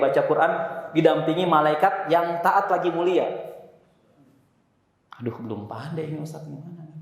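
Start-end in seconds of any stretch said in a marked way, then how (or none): 5.2-6.9 mock timid murmur, much softer; imitating a shy, unsure student. Ustaz imitating a nervous student asking for help, timid murmur. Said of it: "student worried about reading badly".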